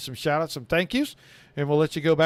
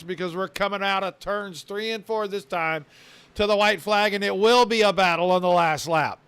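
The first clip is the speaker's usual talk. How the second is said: play-by-play. Acted. Live race play-by-play call.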